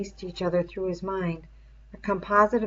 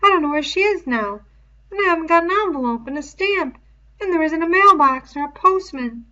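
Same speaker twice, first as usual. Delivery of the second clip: put-on higher, louder boy, hurrying; Piggy's voice; 10 semitones higher, 9 dB louder than usual. A narrator's reading. Piggy answering Ralph about the letter, higher boy voice.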